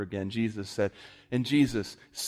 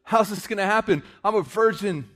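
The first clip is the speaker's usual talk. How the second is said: 1 wonder and amazement; 11 semitones higher, 6 dB louder than usual. Shown as a demonstration. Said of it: "That was".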